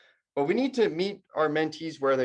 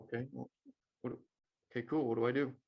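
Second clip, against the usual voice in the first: much softer, lost and let down; deflated mumble; 2 semitones lower, 8 dB quieter, faster than usual. Retold or imitated. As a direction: trail off quietly, at a loss. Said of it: "He's like".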